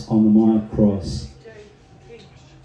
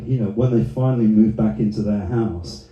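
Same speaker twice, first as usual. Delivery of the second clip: ordinary talk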